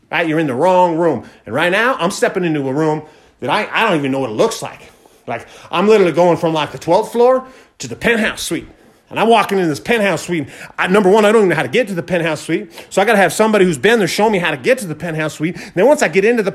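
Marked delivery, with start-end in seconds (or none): none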